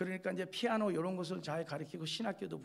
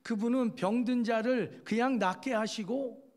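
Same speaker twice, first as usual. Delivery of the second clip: louder, higher preacher's proclamation; fervent preaching; 5 semitones higher, 5 dB louder than usual. Acted. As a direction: proclaim it to the congregation with rising fervour. Preaching Jesus's power at full force.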